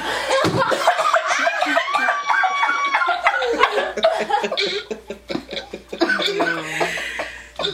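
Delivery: high helpless cackle; real burst of high laughter; long laugh. Real, not acted. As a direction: crack up completely. Cracking up at the answer.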